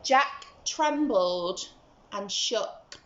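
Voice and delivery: plain reading voice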